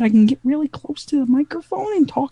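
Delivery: high, squeaky helium talk. Shown as a demonstration. Cast member clowning close to the microphone, helium voice. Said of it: "Get a little helium and".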